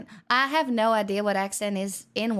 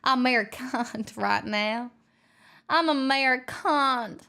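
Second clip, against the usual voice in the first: exaggerated American accent on "American"; mock American accent. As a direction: insist on it in a put-on American accent. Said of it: "have to use an American accent".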